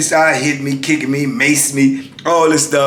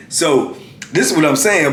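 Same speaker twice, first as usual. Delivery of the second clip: normal talking voice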